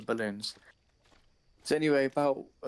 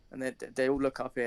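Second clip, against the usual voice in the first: normal talking voice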